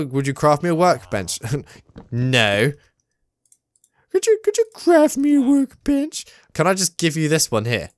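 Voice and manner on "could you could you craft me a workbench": very high-pitched mock pleading; silly put-on voice. Player asking for a workbench, silly high voice. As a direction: squeak the request out in a silly high voice.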